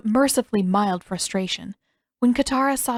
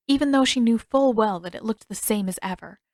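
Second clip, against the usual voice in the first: ordinary delivery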